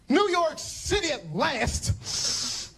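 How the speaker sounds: slow, high, dreamy delight; awed arrival. Retold or imitated. Tourist stepping off the bus, slow and awestruck. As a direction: savor it like a dream come true.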